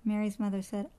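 ordinary delivery

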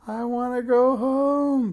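high, loud, slow wail; bellowing children. A narrator's reading. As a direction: howl it like scared kids. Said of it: "bellowed George and Alice".

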